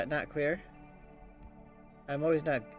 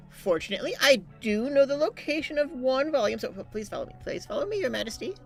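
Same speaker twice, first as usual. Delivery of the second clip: put-on higher, prim old librarian voice; 9 semitones higher than usual. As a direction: play the fussy court librarian. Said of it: "Very good librarian voice".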